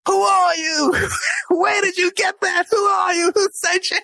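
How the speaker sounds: high, shouted mock Australian accent; hammed-up Aussie accent, drawn-out alarmed questions. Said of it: "Oh, Australian accent".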